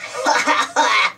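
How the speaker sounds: cheeky little giggle from behind a bush; mischievous snicker; scarecrow giggle. A narrator's reading. Spud sneaking up on Dizzy, giggling. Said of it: "Spud popped up ... and giggled".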